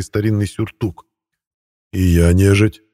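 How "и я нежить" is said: dignified. A narrator's reading. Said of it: "с достоинством сообщил".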